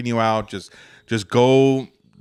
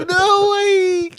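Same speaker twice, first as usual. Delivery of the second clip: very high, tearful wail; hammed-up bawling in falsetto; mock crying; slower than usual. Acting out the maced one whose eyes hurt, mock crying.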